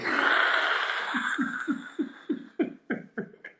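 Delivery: long high-pitched laughing fit; real laughter. Real, not acted. Speaker cracks up at his own point, real laughter.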